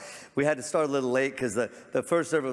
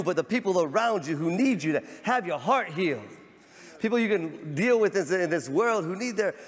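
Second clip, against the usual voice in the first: fervent pulpit exhortation; full-force preaching; 6 semitones higher than usual. Acted. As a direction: exhort the congregation, rising.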